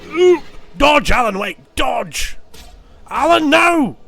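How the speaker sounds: high, loud yells of alarm; panicked shouting. Real, not acted. Player yelling at Alan Wake to dodge, panicked shouts. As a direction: shout warnings at the screen in real panic.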